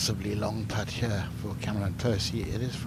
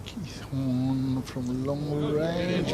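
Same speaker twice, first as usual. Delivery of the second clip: usual speaking voice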